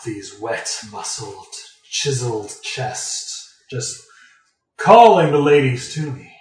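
slow, preening rogue boast, loud finish; swaggering gentleman highwayman. Pretend. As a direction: strut like a dashing rogue. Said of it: "a dashing rogue in a rainstorm".

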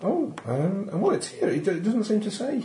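a nervous secretary fumbling the guest list; flustered assistant. Acted.

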